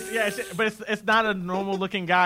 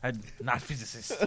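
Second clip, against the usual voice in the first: low, soft, badly faked Russian; half-hearted Russian immigrant accent; 5 semitones lower, 7 dB quieter than usual. Host joining the physicist joke, bad fake Russian accent. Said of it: "can't even fake a Russian accent".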